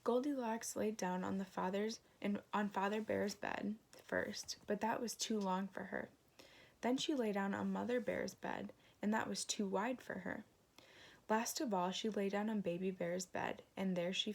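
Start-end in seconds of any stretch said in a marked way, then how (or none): none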